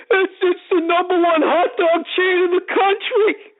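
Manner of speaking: very high, slow, goofy voice; clueless earnest guy. Retold or imitated. Guy in line answers sarcastic lady, dopey earnestness. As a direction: strain it high and slow as an oblivious fan. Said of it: "answer her question like she was being serious".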